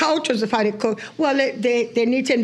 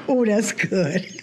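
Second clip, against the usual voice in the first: ordinary talk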